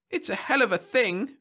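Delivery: high-pitched indignant rant; raving. A narrator's reading. Caught man protesting at copyright charge, raving. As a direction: rave in outrage. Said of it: "raved the other".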